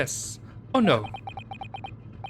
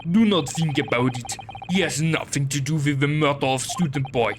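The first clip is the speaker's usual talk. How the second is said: gruff Russian sailor, proud; broken English with a heavy Russian accent; 6 semitones lower than usual. Acted.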